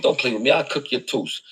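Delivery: ordinary talk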